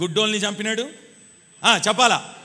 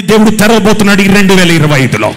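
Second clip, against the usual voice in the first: booming prophetic promise; 16 dB louder than usual. Real, not acted. Preacher promises opened doors this year, booming. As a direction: declare it with full force.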